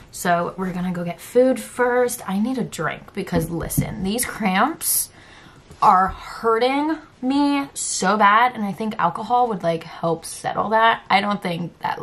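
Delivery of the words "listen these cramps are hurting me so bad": normal talking voice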